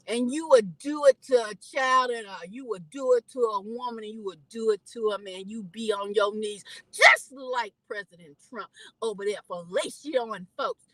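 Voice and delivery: usual speaking voice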